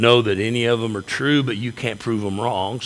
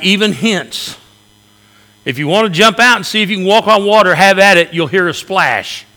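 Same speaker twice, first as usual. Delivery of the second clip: loud, higher fiery preaching voice; preaching at full force; 7 semitones higher, 10 dB louder than usual. Acted. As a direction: preach it out to the whole church. Preacher retells, full force again.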